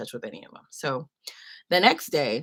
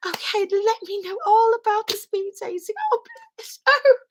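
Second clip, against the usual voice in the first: much higher and louder, gushing kind lady; sweet old lady; 5 dB louder than usual. Retold or imitated. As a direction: squeal with delight like a doting auntie. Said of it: "the lady's farewell".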